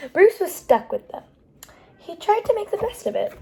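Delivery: usual speaking voice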